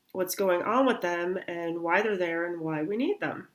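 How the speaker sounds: ordinary delivery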